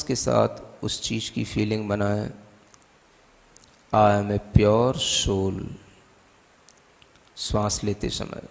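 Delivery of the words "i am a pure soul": very slow, drawn-out affirmation; meditation affirmation. Acted. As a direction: stretch each word out like a mantra. Affirmation within guided meditation.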